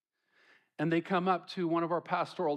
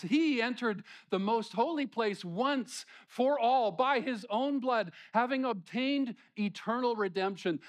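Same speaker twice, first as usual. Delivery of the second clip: raised, measured reading of scripture; 7 semitones higher than usual. Acted. Preacher reading verses to the church, raised and measured. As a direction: proclaim the verses with weight.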